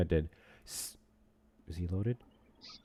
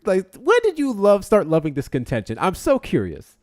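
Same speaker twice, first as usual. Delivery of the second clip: mock outrage; 11 semitones higher, 10 dB louder than usual. High far teasing voice.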